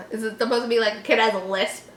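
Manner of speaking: ordinary talk, nothing marked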